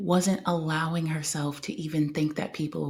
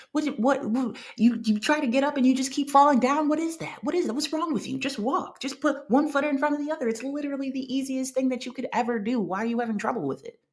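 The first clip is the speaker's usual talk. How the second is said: high, fast mock scolding; mock a parent nagging a crawling baby; berating parent; 8 semitones higher, faster than usual. Imagined parent berating an infant, high fast mock scolding. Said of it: "their parent is like".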